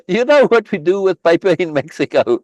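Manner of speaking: loud, high-pitched amused question; tickled, breaking into laughter; amused laughing. Real, not acted. Joking aside while laughing.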